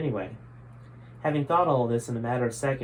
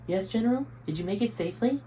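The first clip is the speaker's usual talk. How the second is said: lightly voiced subordinate, higher pitch; a soldier reporting back to his general over the radio; higher assassin voice; 9 semitones higher than usual. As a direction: answer up the line, a bit higher.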